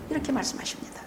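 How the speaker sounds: breathy whisper, no voice; hushed aside to the congregation. Real, not acted. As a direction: drop to a whisper between shouts. Preacher drops to aside.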